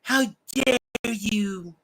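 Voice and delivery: slow, high mock "How dare you?" as Greta; Greta impression. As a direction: mimic the climate activist, dragged out. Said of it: "my little Gretchen, the climate Grinch".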